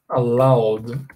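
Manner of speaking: ordinary delivery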